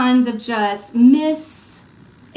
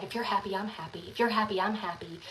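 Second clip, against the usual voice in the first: murmured much softer, falling; quiet rueful echo; 4 semitones lower, 10 dB quieter than usual. Retold or imitated. Mom echoing her son's words, barely above a murmur. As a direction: repeat it to yourself in realization. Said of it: "that's how I've lived my life".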